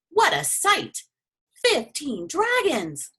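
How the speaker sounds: high, slow amazement; a man amazed at the catch; excited. Acted. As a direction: marvel at the sight. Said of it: "one of the men whistled".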